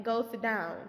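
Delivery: usual speaking voice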